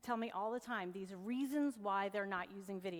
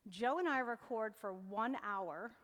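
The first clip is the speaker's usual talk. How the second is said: normal talking voice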